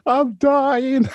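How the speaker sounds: high, slow wailing yell, hammed up; retold panic, drawn-out cry; mock drunken shout. Drunk bloke telling his angry girlfriend he's dying, high mock wail. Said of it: "So I started shouting out".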